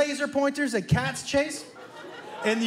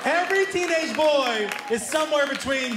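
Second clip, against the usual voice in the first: ordinary delivery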